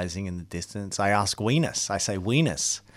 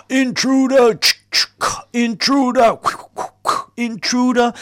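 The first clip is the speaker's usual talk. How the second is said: very high, loud alarm cry; guard's alarm; 12 dB louder than usual. Acted. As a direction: sound the alarm like a piercing siren.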